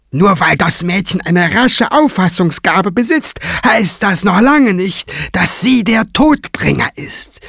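high-pitched, severe character voice; stern, sceptical cleric. Acted. Quiver doubting the girl is Todbringer, stern. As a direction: cut down the hopes with firm doubt.

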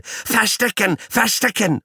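very high squealing yell; yelling guinea pig. Acted. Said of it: "brüllt Paule".

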